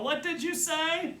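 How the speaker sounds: high, drawn-out shout; yelled question. A narrator's reading. Brother feigning deafness to his sister, yelling. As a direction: call slowly as if unable to hear. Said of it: "I yell".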